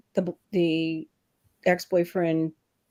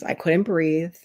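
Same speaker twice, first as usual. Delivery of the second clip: ordinary delivery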